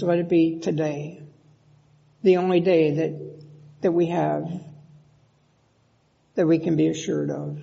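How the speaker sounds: low, measured pastoral prayer with long pauses. Real, not acted. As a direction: pray earnestly to God, unhurried, letting phrases settle. Leads a prayer.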